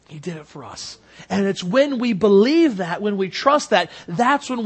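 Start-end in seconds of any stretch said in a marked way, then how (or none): 0.1-1.0 much softer, almost breathed; quiet, low echo of the line; hushed repeat. Real, not acted. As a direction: let it fall to a hush.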